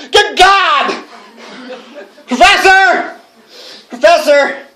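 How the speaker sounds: shrieking, horrified calls; horrified shout. Acted. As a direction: scream for the professor in shock. Horror finding blood.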